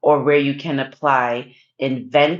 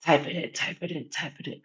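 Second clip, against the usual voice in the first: gangster murmur; 9 dB quieter, much faster than usual. Retold or imitated. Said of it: "they're whispering".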